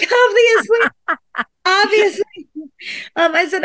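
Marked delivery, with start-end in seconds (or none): 0.0-2.5 laughing relief. Retold or imitated. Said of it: "I'm like".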